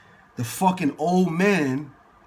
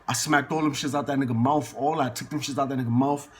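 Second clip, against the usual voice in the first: ordinary talk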